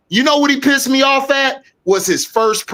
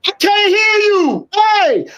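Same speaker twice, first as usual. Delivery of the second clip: much higher yell; mock heckling shout; 11 semitones higher, slower than usual. Host heckling the coach at his press conference, shouting high. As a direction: heckle him like he's far away. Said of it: "And I'm like".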